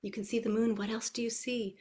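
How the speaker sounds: hushed wondering voice for toddlers; very soft, as if everybody is asleep; animated teacher voice. Shown as a demonstration. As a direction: draw small children in with a near-whisper. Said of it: "So this is my animated voice".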